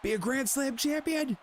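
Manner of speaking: high, drawn-out ringside suspense; mock commentator. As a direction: play up the title drama in a high pitch. Mock commentary during the game's match.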